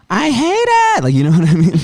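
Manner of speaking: teasing a friend by mimicking her; imitating Dulce. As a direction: parrot her words in a silly high voice. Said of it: "Dulce's talking".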